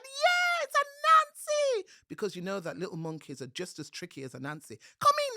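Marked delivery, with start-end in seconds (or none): 0.0-1.8 very high, loud, squealing monkey cry; thrilled little monkey spotting a visitor; excited monkey. A narrator's reading. Monkey greeting Anansi. 5.0-5.4 very high, loud, squealing monkey cry; thrilled little monkey spotting a visitor; excited monkey. A narrator's reading. Monkeys inviting him in.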